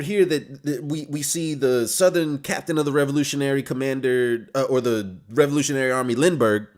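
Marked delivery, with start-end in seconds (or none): none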